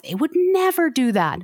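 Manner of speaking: high, incredulous voice mimicking defensive church folk; a mocking impression of people brushing off abuse; mock indignant denial.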